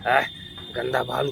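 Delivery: ordinary talk, nothing marked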